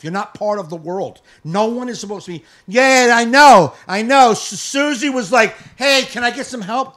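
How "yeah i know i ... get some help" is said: loud, high and slow; mocking a shopper on a call; mock phone chatter. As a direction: parody someone yapping on the phone.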